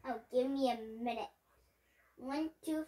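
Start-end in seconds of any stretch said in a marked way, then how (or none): none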